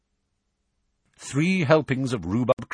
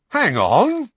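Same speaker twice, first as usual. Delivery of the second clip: high, loud objection; sharp rise in pitch and volume; loud interruption; 14 semitones higher, 9 dB louder than usual. A narrator's reading. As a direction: butt in loudly. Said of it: "interrupted George loudly".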